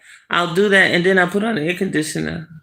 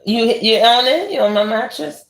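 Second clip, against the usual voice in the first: ordinary talk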